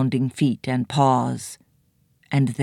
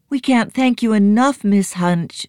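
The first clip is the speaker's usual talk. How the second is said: put-on grateful girl; 8 semitones higher, 4 dB louder than usual. A narrator's reading. Nancy thanks the crone.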